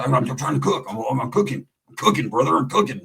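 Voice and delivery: lightly voiced tweaker, stammering and low; tweaked out. Tweaker in the garage insisting he's cooking, mock tweaked-out. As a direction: stumble over it like a mock tweaker. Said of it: "he'd be there tweaked out".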